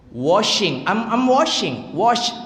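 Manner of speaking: washing with a clear, full sh; shin in wash. Shown as a demonstration. Teacher shows wash uses shin, full sh sound. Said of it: "Wash itu shin hurufnya".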